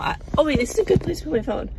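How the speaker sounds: fast, keyed-up chatter; buzzing with news; excited. Real, not acted. Excited rush after surprise.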